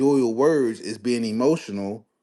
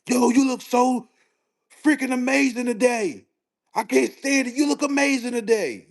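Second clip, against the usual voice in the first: yelled compliment; 12 semitones higher than usual. Shown as a demonstration. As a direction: shout a compliment at full volume. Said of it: "if I yell and say".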